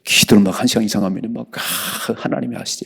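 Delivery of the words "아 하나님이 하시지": soft, relieved sigh of trust; hushed relieved murmur after long prayer; contented sigh. Retold or imitated. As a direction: breathe it out softly, at peace. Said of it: "이렇게 해요".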